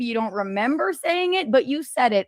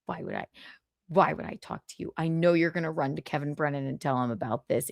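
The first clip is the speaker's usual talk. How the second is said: lower, softer, whispered fast parody; mocking hushed quote; 7 semitones lower, 9 dB quieter, faster than usual. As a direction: whisper his words back at him, quick and sneering. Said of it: "but it was like".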